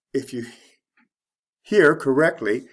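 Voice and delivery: ordinary talk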